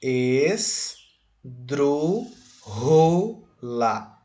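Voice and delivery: "Es... drú... xu... la", very slow with gaps; syllable by syllable. Shown as a demonstration. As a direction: break "Esdrúxula" into four separate drawn-out syllables. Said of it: "essa palavra esquisita aí".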